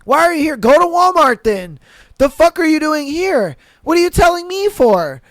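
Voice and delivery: mock outburst at a cheapskate shopper; exasperated yelling. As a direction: blast the customer in disbelief. Rant at price-arguing customer.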